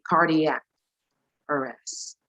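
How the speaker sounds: "cardiac" in three syllables, CAR-dee-ak; careful "cardiac", car-dee-ak; standard pronunciation. Shown as a demonstration. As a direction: sound out the d and ee in "cardiac". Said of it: "supposedly you're gonna say".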